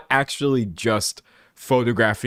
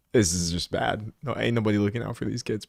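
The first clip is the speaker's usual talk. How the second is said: ordinary talk, nothing marked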